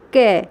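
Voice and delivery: कै said alone, low and held to show the ए sound. Shown as a demonstration. Teacher to children, showing the ए sound in कै clearly. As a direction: demonstrate कै for the children, stretching the vowel. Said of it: "देखो आवाज़ आ रही है".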